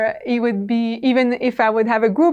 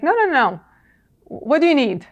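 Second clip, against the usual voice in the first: higher-pitched imitation of someone insisting; insistent; 6 semitones higher than usual. Man insists to caller he is fine to talk, mock insistent. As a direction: retell a caller's quick, insistent brush-off. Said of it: "he insisted".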